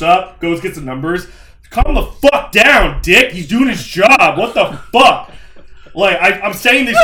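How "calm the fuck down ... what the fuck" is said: louder, higher, slow and hard; retold telling-off, loud and deliberate; angry scolding. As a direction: rein in the panicking father. Scolding dad on showroom.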